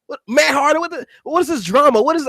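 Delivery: mock-agitated, high incredulous questioning. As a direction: replay your agitated disbelief, pitch jumping up. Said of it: "I was getting agitated".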